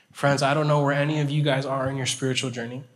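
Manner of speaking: ordinary talk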